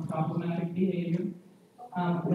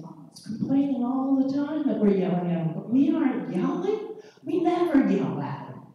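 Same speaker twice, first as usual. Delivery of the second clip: higher-pitched parent protest, loaded with inflection; heavy inflection; 7 semitones higher than usual. Acted. Parent protesting to a clinician about yelling, heavily inflected. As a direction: lots of swooping emphasis without shouting. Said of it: "but I put a lot of inflection into it".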